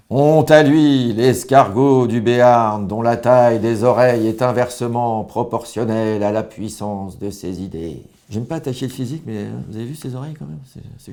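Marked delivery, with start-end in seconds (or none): 0.1-8.0 slow, loud senate-floor declamation; orator's punchline. Acted. Senator reading his attack speech on Bayrou, slow and loud. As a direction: hold the tribune and hammer each word slowly. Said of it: "J'ai écrit quelques punchlines".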